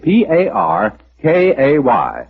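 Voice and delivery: ordinary delivery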